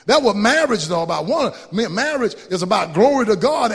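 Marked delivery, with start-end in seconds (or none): none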